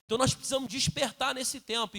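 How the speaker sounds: normal talking voice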